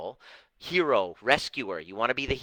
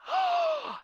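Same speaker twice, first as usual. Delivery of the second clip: mock gasp. Dramatic realization of childhood wound.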